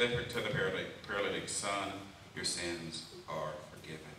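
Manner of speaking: hushed reading. Shown as a demonstration. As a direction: let the verse sink in quietly. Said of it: "Look at this".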